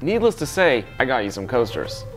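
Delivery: usual speaking voice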